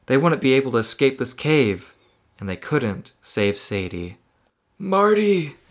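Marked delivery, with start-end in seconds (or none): 4.8-5.6 high, wavering-elder style call; old person voice. Acted. Mysterious voice calling Marty in his head, old person voice. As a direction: call out as a wrinkly old vampire. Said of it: "he gets old and he sounds like this".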